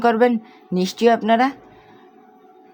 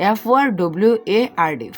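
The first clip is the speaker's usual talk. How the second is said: fast spelled-out letters; spelling forward letter by letter, quick; faster than usual. Shown as a demonstration. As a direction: rattle off each letter of forward. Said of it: "এরকম ভেঙে ভেঙে উচ্চারণ করবেন".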